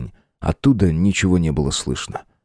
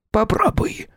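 high eerie whisper; slower than usual. Acted. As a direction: breathe it like a spirit. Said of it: "засмеялся голос из колодца".

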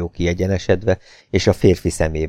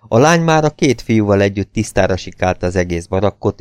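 plain reading voice

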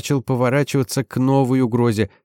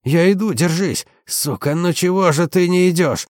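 high, loud, desperate yelling; desperate shout; 7 semitones higher, 3 dB louder than usual. A narrator's reading. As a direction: cry out in panic for a comrade. Desperate cry to fallen comrade.

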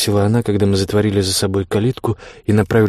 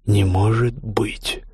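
ominous tone; much slower than usual. A narrator's reading. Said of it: "сказал я тоном, от которого она вздрогнула".

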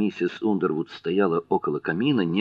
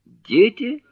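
very high, louder rising question on «Дети?»; startled question; 5 dB louder than usual. Acted. Jherek catches at the mention of children, startled and high. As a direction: shoot the voice right up in surprise. Jherek suddenly struck by the word.

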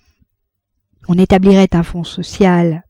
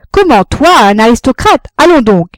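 mocking heckle; 6 semitones higher, 7 dB louder than usual. A narrator's reading. Crowd heckling the speaker.

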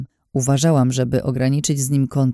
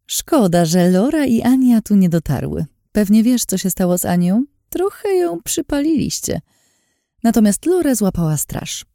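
higher, smug, teasing voice; sly Lin, taunting; 6 semitones higher than usual. A narrator's reading. Lin teasing Anna she has seen through her disguise, sly and smug. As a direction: purr it out like a scheming woman. Lin's voice in narration.

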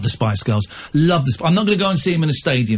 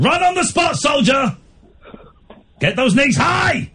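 barked high army commands; drill sergeant shout; 11 semitones higher, slower than usual. Pretend. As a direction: bellow like a drill instructor. Drill sergeant barking orders.

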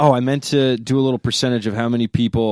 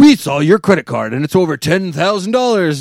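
telemarketer voice; 6 semitones higher, 7 dB louder than usual. Retold or imitated. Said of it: "A A R P".

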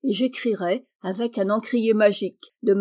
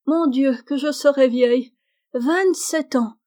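put-on higher and louder, a girl horrified at growing old; dismayed exclamation; 5 semitones higher, 4 dB louder than usual. A narrator's reading. Meg counts the years ahead and exclaims in mock dismay. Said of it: "s'écria Meg".

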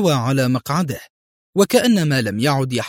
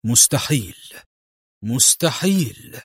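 lightly voiced despair; slightly crushed disbelief; murmured collapse; slower than usual. A narrator's reading. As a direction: repeat it as if crumbling, slowing down. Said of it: "وهو يغمغم في انهيار".

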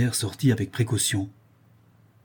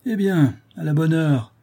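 higher, louder exclamation for all to hear; raised voice; 7 semitones higher, 5 dB louder than usual. A narrator's reading. Bailiff speaks up for the artisan to hear, raised voice. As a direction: speak up loudly and high. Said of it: "reprit Bourdin à voix haute".

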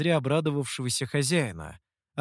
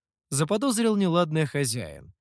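ordinary delivery

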